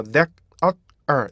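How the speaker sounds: "that, uh, er" as three separate beats. Shown as a demonstration. Teacher splitting the word into three beats. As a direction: split "there" into "that, uh, er". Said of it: "이렇게 읽어야 돼요".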